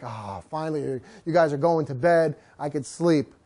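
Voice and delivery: low, soft voice of a relieved dog; relieved tired dog. Acted. Dog whose family finally goes to bed, weary relief. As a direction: sigh with relief, settling down for sleep. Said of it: "he's like".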